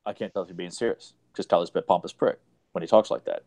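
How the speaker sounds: low, muttering send-up; mocking Joe. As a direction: parody a friend's pompous complaint, low and soft. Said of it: "this whole point of".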